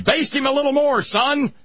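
high, loud demon father at the barbecue; high-pitched play-acted imp; little demon. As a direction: call out gleefully as a cartoon demon cook. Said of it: "so little demons can barbecue him".

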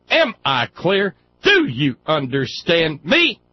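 barking officer, loud and high; drill sergeant. Retold or imitated. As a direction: bellow orders like a drill sergeant. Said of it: "Yes, drill sergeant".

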